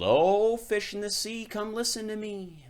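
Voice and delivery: high, loud call to the sea; sing-song call across the water. Acted. Fisherman calls out over the sea to the fish, high loud call.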